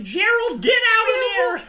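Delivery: high, loud yell at her son; bursting in and yelling; shouting mom. Retold or imitated.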